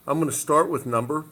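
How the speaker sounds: talking through hiccups; interrupted by hiccups. Real, not acted. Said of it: "I got the hiccups".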